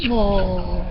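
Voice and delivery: soft, high cooing sigh; touched aww. A narrator's reading. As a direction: coo at a sweet reunion. Crowd moved by the hug.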